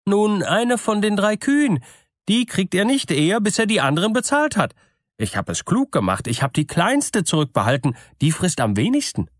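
a simple wife proud of her clever trick; wife's voice. A narrator's reading. Wife answers her husband. (naive Bäuerin, leicht höher gesprochen)

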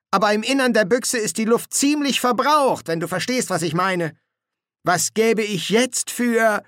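high-pitched, put-upon sergeant; grumbling sergeant. Acted. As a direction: air your complaint to your mate. Colon complains in disguise.

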